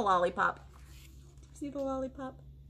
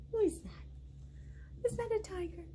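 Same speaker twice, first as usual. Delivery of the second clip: whispered, higher, soft question; hushed mystery; 8 semitones higher, 9 dB quieter than usual. Acted. Teacher wonders aloud to kids.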